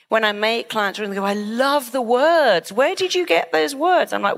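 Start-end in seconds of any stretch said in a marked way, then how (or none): 1.2-4.1 delighted client. Retold or imitated. Client praising the therapist's words, delighted. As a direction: gush with admiring curiosity.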